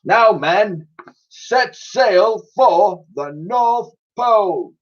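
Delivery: slow, high commanding admiral; addressing the crew. Acted. Admiral addressing his crew, slow commanding voice. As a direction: proclaim it to the crew. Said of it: "The Admiral addressed his crew".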